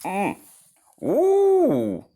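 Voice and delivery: high delighted ooh; excited ooh. Real, not acted. Reader thrilled at the castle dream, high ooh. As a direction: squeal it with wonder.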